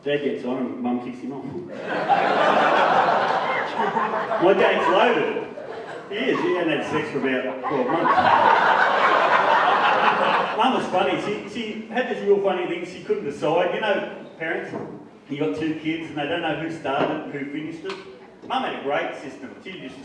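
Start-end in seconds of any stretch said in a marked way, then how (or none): none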